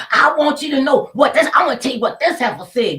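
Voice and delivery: high, loud, furious complaint; angry shout at the door. Retold or imitated. Adult neighbour yelling at the mother's door, furious. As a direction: bang on the door and yell.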